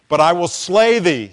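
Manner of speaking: loud, threatening emperor's command; cold rasping evil emperor. Acted. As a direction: bellow a death threat like a tyrant. Sermon acts out emperor threatening Chrysostom.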